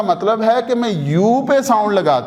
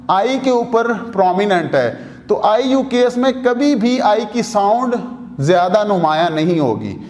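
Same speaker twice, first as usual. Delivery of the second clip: ordinary delivery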